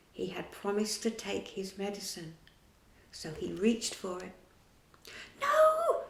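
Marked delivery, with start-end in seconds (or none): 5.4-6.0 very high, loud squeaky shriek; a tiny fairy screaming to stop him. A narrator's reading. Tinker Bell shrieks to stop Peter drinking. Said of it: "shrieked Tinker Bell".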